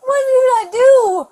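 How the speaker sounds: slow, tearful plea; crying. Real, not acted. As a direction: sob it out, bewildered. Handcuffed woman breaking down crying.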